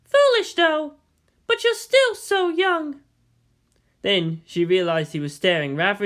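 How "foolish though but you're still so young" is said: much higher, slow teasing woman's voice; Misato voice. Acted. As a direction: tease him slowly from up high. Misato's voice in story.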